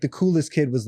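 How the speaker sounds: ordinary talk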